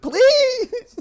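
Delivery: very high, hammed-up begging wail; comic impression of a suspect begging; frantic drunk pleading. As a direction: keep pleading nonstop in a high, loud voice. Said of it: "This guy won't stop".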